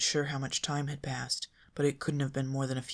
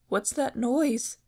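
scared Shaggy; 10 semitones higher, 6 dB louder, slower than usual. A narrator's reading.

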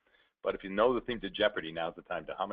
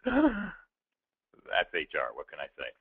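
audience quip; 4 semitones lower, much slower than usual. Retold or imitated. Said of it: "all I hear from the entire audience is".

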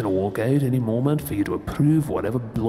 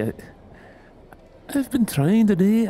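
normal talking voice

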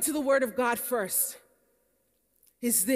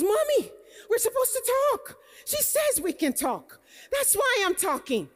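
put-on higher, childlike protest; imitating her son; 9 semitones higher than usual. Young son defending himself to his mother, in a higher, childlike voice. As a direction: play the little boy insisting he's right.

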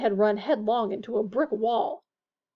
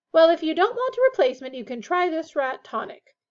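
higher, louder, fast witch; brisk witch; 6 semitones higher, 7 dB louder than usual. A narrator's reading. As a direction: rattle off the offer briskly. Witch voiced in the story.

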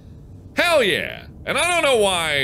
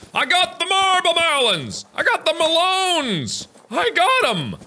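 high excited crowing; triumphant; 13 semitones higher than usual. Real, not acted. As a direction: crow with glee.

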